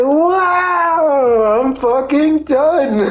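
high, long wail of defeat; mock exasperation. Retelling a player quitting in defeat, mock exasperated. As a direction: draw out each word, high and hammed-up. Acts out beaten player giving up.

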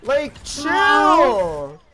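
drawn-out exasperated yelling; frustrated shout. Real, not acted. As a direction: yell it out in frustration. Frustrated outburst in game.

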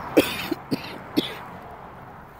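long coughing fit from getting worked up; choking cough. Real, not acted. Worked up telling the daughter's story, choking cough. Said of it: "I'm choking".

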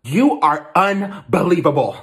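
loud, slow, exasperated outburst. Acted. Stepmother to stepson mocking her stern voice, exasperated. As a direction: draw each word out in loud disbelief. Stepmother mocked in chore quarrel.

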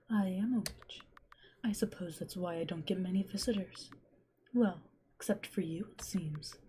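soft, hushed kindly witch; whispering witch. Acted. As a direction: murmur it like a lonely witch in the woods. Witch voice held.

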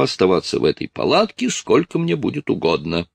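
lightly acted indignant retort; lightly voiced defiant officer. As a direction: argue your rights point by point. Voices Feodosy's defiant speech to Strus.

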